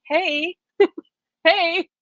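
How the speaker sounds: a pleading emotion calling high, then softening; voice of your emotions. Acted. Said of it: "your emotions are simply saying".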